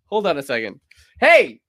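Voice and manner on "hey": loud, higher hey; calling out. Real, not acted. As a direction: call across the room. Calling out suddenly.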